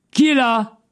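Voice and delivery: héler d'une voix haut perchée; appel au loin. A narrator's reading. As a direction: call out high into the distance. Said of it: "Il l'appela". (appeler de loin, voix aiguë)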